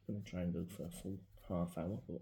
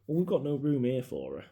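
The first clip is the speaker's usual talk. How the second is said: louder, higher mock of a blunt dad; dad's flat refusal; 6 semitones higher, 9 dB louder than usual. Son mimics dad refusing to keep the ashes: loud blunt.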